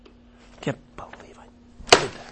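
hushed self-reproaching whisper. Acted. Pastor voices a sinner scolding himself in a whisper. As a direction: breathe it out ashamed under your breath.

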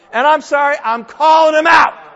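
high, full-force preacher's shout; shouted denunciation. Real, not acted. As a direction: call him out at the top of your voice. Preacher denouncing a false prophet.

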